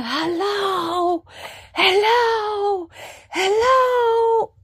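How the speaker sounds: hoarse cries out the door for the nurses; yelling hello. Retold or imitated. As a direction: shout desperately for help. Calling out for nurses.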